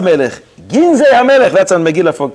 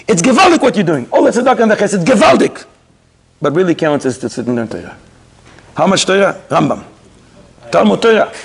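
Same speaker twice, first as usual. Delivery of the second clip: ordinary talk, nothing marked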